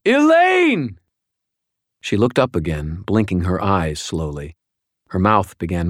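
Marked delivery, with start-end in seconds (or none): 0.1-1.0 high piercing scream of her name. A narrator's reading. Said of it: "I screamed".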